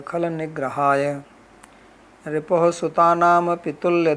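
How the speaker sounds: loud, measured chant of a scripture verse; loud devotional verse chant; Sanskrit verse recitation. Acted. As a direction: intone it as a sacred verse.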